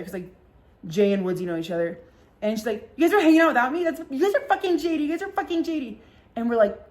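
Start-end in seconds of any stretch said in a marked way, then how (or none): 3.0-6.0 put-on high-pitched drunken accusation; whipped-up high mock outrage; mock outraged friend. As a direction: imitate her indignant, aggrieved outburst. Friend accuses them in retold quarrel.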